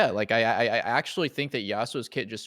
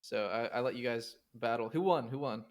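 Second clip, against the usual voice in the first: far from mic. Real, not acted. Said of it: "Sound like you're far away".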